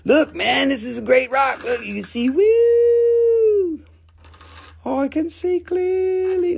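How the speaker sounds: high, squealing excited voice; hammed-up high-pitched delight, woo included; over-excited persona. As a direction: hype the rock like a giddy kid.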